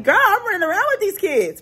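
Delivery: high-pitched, mock-exasperated comeback; exasperated retort. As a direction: act out a worn-out mom's protest, pitched high. Acts out exasperated reply to grandma.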